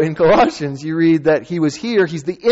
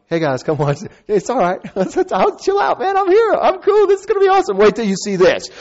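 much higher, breezy laid-back dude; hammed-up chill surfer voice; casual cool guy; 10 semitones higher, 3 dB louder than usual. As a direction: act like it's no big deal, all swagger. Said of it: "walking up to the tomb like".

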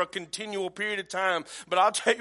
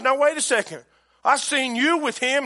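higher, louder, calling someone out; acted accusation; 5 semitones higher, 5 dB louder than usual. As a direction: accuse him as a sudden witness. Acted little girl accusing Peter.

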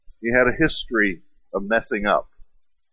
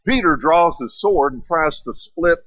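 usual speaking voice

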